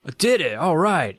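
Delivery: high-pitched mock excitement; acted delight. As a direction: play the pleased, carefree record-breaker. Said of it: "and he's like".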